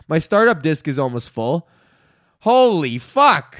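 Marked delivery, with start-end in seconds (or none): 2.4-3.6 loud, slow, drawn-out shock; stunned curse. Real, not acted. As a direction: let the curse hang in disbelief. Reacting to full startup disk.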